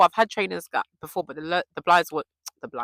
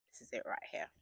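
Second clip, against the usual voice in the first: whisper; 7 semitones lower, 20 dB quieter, faster than usual. Real, not acted. Showing new journal to camera.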